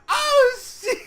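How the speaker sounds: high, loud excited yelp; shocked yell. Retold or imitated. Fan reacting to Bewear's entrance with a shocked yell. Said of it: "And I was like".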